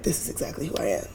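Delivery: fast, breathy whispered confession. Retold or imitated. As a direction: whisper it like a secret. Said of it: "I'm like".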